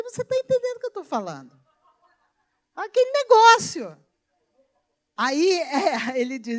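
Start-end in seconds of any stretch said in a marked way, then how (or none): 0.0-4.0 fast, high retort ending in a louder jab; playful retort. Retold or imitated. Wife answering her husband, fast, high and teasing. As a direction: toss it back quickly, high and teasing. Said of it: "Eu falei".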